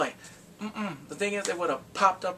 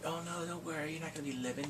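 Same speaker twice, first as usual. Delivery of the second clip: quiet menace; 10 dB quieter than usual. Retold or imitated. Kidnapper to taped-up captive, quiet menacing reassurance. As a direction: drop the volume for a veiled threat.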